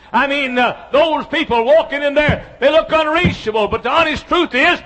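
loud, high, driving pulpit voice; full-force preaching. Acted. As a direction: hammer each line home to the congregation.

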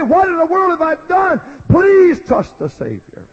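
loud, high begging call; impassioned pleading preacher. Acted. Preacher begging the congregation to trust the Savior, loud. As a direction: plead with them to be saved.

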